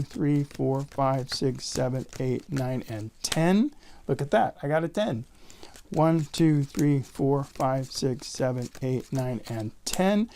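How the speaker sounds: ordinary talk, nothing marked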